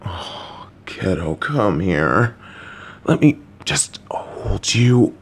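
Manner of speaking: hushed, slow whisper; soft whisper. Acted. Parent drawing the child in for a hug, soft and hushed. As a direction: breathe it softly as you pull them in.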